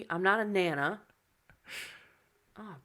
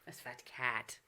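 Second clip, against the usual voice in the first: ordinary delivery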